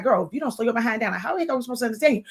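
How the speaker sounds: rushed, tumbling fast scolding; mock friends complaining at full speed; rapid-fire quote. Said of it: "And it's like".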